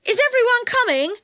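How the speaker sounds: high-pitched call to people below. A narrator's reading. Joe calls down to those below him, high and carrying. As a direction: call down to check on them. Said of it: "he called back".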